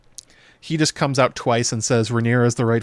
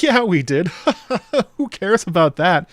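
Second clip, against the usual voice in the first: higher, louder, laughing it off; laughing dismissal; 8 semitones higher, 4 dB louder than usual. Retold or imitated. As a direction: shrug off the vows with a laugh. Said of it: "the guys are like".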